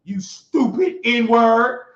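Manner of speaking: usual speaking voice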